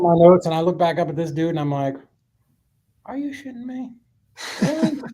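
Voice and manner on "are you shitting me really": high-pitched mock disbelief; incredulous. Listener reacting to old Bob's deal, high incredulous disbelief. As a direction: squeak it out, utterly thrown.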